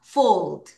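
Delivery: "fold" modelled as one syllable, low and falling; teacher's model of "fold", falling at the end; pronunciation demo. Shown as a demonstration. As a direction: say "fold" low for the class to copy. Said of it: "발음해봐요".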